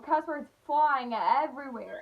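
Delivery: ordinary talk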